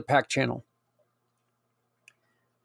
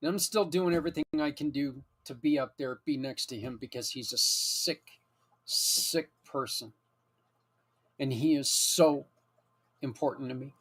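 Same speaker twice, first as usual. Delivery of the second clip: soft, choked-up words, much quieter, holding back tears; barely keeping it together; real emotion breaking through, very soft; near tears; 2 semitones lower, 9 dB quieter than usual. Real, not acted. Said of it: "I'm gonna start crying here in a second".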